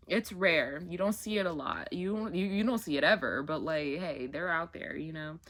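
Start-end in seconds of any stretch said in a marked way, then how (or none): none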